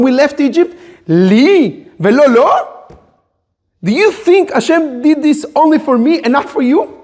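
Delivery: high, insistent challenge, rising into questions; intense exclaiming question. Shown as a demonstration. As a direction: ask it as an astonished exclamation. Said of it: "everything said with exclamation and with a question".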